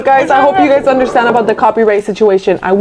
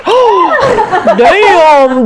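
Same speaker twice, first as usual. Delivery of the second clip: gasping laughing shout; surprised shout; 8 semitones higher than usual. Real, not acted. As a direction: blurt it out laughing. Gasp and laugh at spill.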